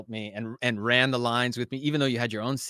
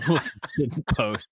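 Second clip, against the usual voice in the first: laughing imitation; 2 semitones higher, 3 dB louder than usual. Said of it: "he's like".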